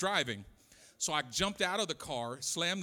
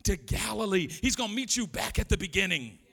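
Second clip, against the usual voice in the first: high, loud revival preaching cadence; full-force preaching; 7 semitones higher, 6 dB louder than usual. Real, not acted. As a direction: build to a ringing, driving sermon climax.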